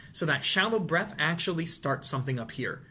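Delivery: usual speaking voice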